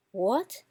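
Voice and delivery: "what" as a rising second tone. Shown as a demonstration. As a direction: lift "what" like a question. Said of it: "Let's say it again".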